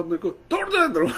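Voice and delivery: high, laughing playful threat; laughing tease. Retold or imitated. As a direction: laugh it out with affection. इतनी जोर से smile दी. (हँसते हुए प्यार भरी धमकी)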